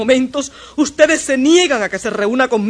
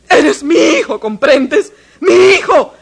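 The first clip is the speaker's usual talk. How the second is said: higher, louder outburst; furious claim; 5 semitones higher, 4 dB louder than usual. Acted. Mother furiously claiming her son, shouting. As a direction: shout the claim over the boy. Mother threatens to take him.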